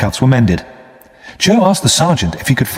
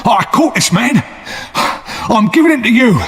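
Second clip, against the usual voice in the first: cried out; 12 semitones higher than usual. A narrator's reading. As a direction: force the soldiers to listen. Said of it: "he cried".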